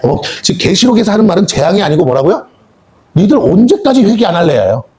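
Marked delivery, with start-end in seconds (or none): none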